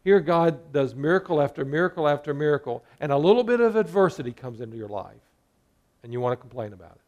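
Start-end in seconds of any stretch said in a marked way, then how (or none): none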